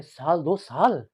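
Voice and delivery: slow, dragged-out astonished echo; surprised question. Acted. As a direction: repeat it in disbelief, stretching it. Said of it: "हैरान होकर पूछते हैं".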